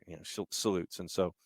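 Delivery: ordinary talk, nothing marked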